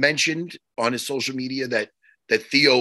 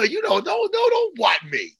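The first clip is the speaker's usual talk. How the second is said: mock indignant shout; 11 semitones higher than usual. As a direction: shout it in fake hurt. Mock outrage at Jimmy.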